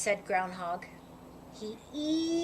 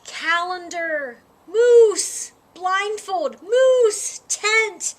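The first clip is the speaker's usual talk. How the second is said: loud bellowing, each Moose much higher; 7 semitones higher, 10 dB louder, much slower than usual. A narrator's reading. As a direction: bellow it out, building frustration. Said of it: "bellowed Moose".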